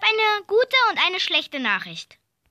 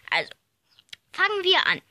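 ordinary talk

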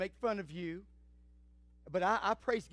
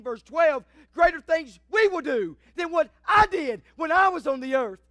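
high, loud revival-style proclamation; fervent pulpit cry, pitched up and pounding; full-force preaching; 7 semitones higher, 11 dB louder than usual. Real, not acted. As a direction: build the sermon in big ringing phrases.

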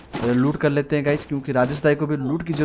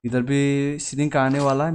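usual speaking voice

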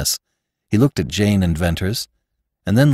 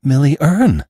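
higher, louder astonished cry; wondering cry; 7 semitones higher, 5 dB louder than usual. Acted. Jane recognizes the portrait, a wondering cry. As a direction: gasp out the name in wonder. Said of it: "she cried wonderingly".

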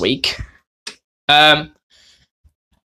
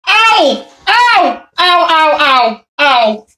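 crying ow in pain; 7 semitones higher, slower than usual. Real, not acted. Hurt, nose just broken.

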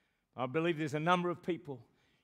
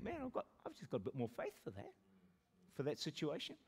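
softer, wondering self-talk; quiet inner thought; 2 semitones lower, 9 dB quieter than usual. Pretend. Pastor voicing a listener's later thought, soft. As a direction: think it to yourself. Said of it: "you're gonna think".

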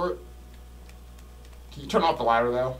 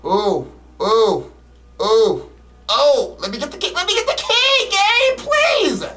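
high panicked begging; frantic pleading; 10 semitones higher, 4 dB louder, slower than usual. Real, not acted. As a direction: beg the game in a panic. Frantic grab for the key.

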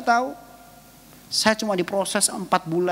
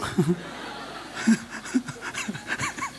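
breathy laugh; 2 semitones higher, 7 dB quieter than usual. Real, not acted. Laughs at own joke.

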